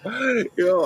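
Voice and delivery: ordinary talk, nothing marked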